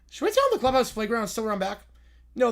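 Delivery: usual speaking voice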